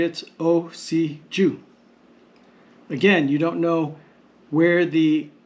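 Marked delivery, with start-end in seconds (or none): 0.4-1.6 slow "osi ju", each syllable apart. Shown as a demonstration. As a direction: spell out "osi ju" as o, si, ju. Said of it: "So in the syllabary".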